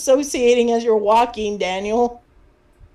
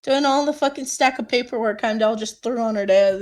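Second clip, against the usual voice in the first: ordinary talk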